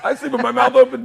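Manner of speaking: high, loud laugh through the words; laughing while talking. Real, not acted. Father laughing at his own story, high loud laughter. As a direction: crack up mid-line. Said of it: "I wanna sleep".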